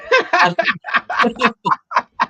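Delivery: loud, high burst of real laughter; hearty laugh. Real, not acted. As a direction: crack up laughing, long and loud. Cracks up at the accent answer.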